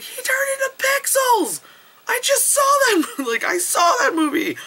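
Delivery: very high comic squeal; hysterical disbelief. Retold or imitated. Friend cracking up at pixel death, squealed disbelief. Hammed-up reaction to film death.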